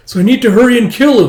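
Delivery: loud, high, insistent; angry shout. Acted. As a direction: shout her resolve. Arguing with Tao.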